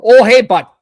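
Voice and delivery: a folksy Canadian support guy, eh; Canadian tech support. Retold or imitated. Host mocks Canadian tech support, hammed-up Canadian accent. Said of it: "Canadian tech is about".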